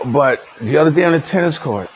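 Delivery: ordinary talk, nothing marked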